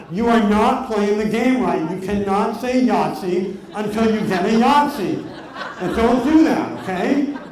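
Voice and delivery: half-laughing, mock furious telling-off; angry scolding. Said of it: "I literally started to get angry".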